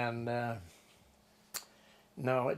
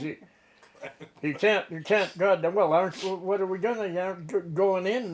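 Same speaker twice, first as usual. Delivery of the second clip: higher, louder, stumbling objection; retold protest; 5 semitones higher, 6 dB louder than usual. Hungry deckhand questions skipper in bad weather, loud protest. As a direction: protest in disbelief, tripping over the words.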